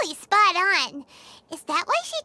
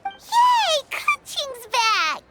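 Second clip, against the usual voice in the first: excited cheer; 4 semitones higher, much slower than usual. Real, not acted. Keqing is back, greet her with a delighted squeal. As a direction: cheer as your friend walks back in. Friend returns.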